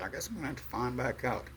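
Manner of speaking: usual speaking voice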